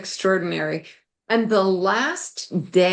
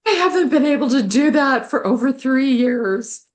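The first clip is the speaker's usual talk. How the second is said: retold cry of joy, higher and louder, laughing through it; laughing disbelief; 6 semitones higher, 5 dB louder than usual. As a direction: laugh out the amazement, voice lifted and loud. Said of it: "And I just was like".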